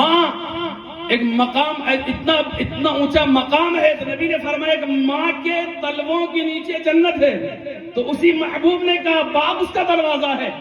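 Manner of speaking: slow, high, moved preaching about mother; impassioned preaching. Acted. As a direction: stretch each word, voice near tears. (جذباتی انداز میں آہستہ اونچی آواز سے وعظ)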